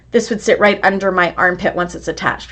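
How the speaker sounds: usual speaking voice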